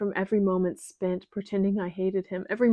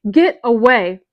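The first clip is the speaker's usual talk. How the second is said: loud crazed shout; crazed furious yell; lethal, crazed; 3 semitones higher, 12 dB louder, slower than usual. Acted. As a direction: threaten them like a madwoman. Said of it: "My voice is lethal, crazed".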